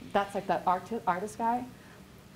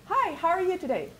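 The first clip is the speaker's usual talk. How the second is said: much higher, bright and engaged hi; warm friendly greeting; 8 semitones higher than usual. Shown as a demonstration. Rick greeting people on the phone, warmly and higher. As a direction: greet the stranger like you really care. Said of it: "he says it not just".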